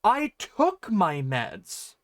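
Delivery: slow, drawn-out "I took my meds" with TOOK stressed; defensive emphasis on TOOK, stretched out; emphatic, invested. Shown as a demonstration. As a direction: lean hard on "took", insisting. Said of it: "sounds emphatic and invested".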